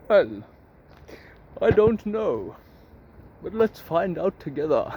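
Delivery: lightly voiced philosopher, pausing after the first word; a hint of a put-on sage, unhurried; Socrates. Philosopher answering the geezer's question, lightly put-on sage voice. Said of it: "Socrates is like".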